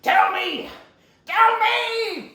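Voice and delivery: high, loud, escalating shouts; desperate demand. Acted. Villain demands his master's pride, desperate shouting.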